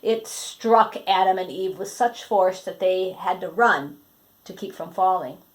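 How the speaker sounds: ordinary delivery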